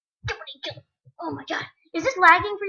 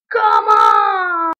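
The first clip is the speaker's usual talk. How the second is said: loud, drawn-out plea; exasperated shout; 2 semitones higher, 8 dB louder than usual. Real, not acted. Gamer who died again to the game, exasperated shout. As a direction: yell at the unfair game.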